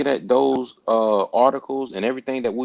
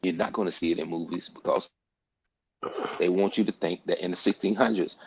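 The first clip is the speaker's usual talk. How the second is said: ordinary talk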